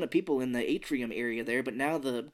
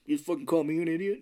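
offended alien voice; 3 semitones higher, faster than usual. Retold or imitated. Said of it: "Gribble's like".

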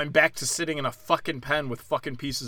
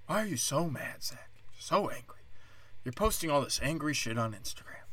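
much softer, mocking whiny tone; mock critics; 9 dB quieter than usual. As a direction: hush it down like a nagging critic. Mocking critics asking why he's mad.

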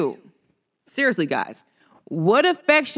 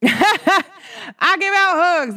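high, slow, drawn-out with a laugh; laughing sing-song; 9 semitones higher, much slower than usual. Shown as a demonstration. Telling friends she gives hugs, slow laughing sing-song. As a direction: stretch each word out, laughing and much higher.

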